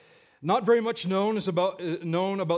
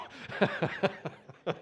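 breathy laugh; 4 dB quieter than usual. Real, not acted. Laughs at his own story.

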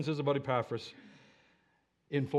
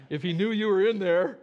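ordinary delivery

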